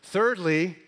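loud, building pulpit cadence; rising sermon climax; preaching at full force. Acted. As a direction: drive each point home to the congregation. Climactic list of affirmations.

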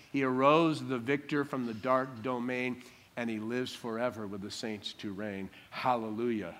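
low, hushed recital of a hymn; hymn recitation. Acted.

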